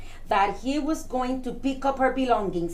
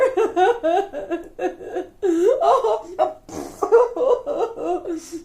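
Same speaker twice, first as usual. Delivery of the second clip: high, pulsing boo-hoo; hammed-up baby-like sobbing; mock bawling; 5 semitones higher than usual. Bug girl bawls over her lost job, hammed-up crying. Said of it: "Stop crying like a baby".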